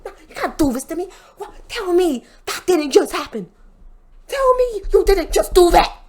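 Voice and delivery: pissed-off mock rant, high-pitched and spluttering; Booker T. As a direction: rant in outraged disbelief, pitch jumping up. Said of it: "Booker T's pissed off".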